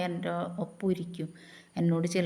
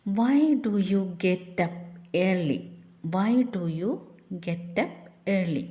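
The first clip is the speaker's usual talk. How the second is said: very slow, word by word; slow dictation; much slower than usual. Shown as a demonstration. Teacher reading the English prompt slowly to the class. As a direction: spell out each word. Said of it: "slow English model phrase".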